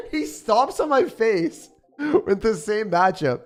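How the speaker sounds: high, drawn-out laughing gripe; slow laughing complaint. Real, not acted. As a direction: stretch it out while laughing.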